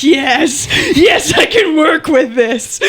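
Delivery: laughing, gleeful yes. Retold or imitated. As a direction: crow with delight. Gleeful retold reaction to pool hustle.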